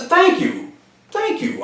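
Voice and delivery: very high, grateful voice; imitated relieved gratitude; grateful relief. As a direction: retell heartfelt thanks, pitched right up. Retold man thanks him for reassurance.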